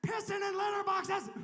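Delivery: high, loud mock outrage; hammed-up moral panic shout; alarmist media. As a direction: cry it out like a scandalised media report. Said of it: "the media says things like".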